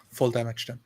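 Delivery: usual speaking voice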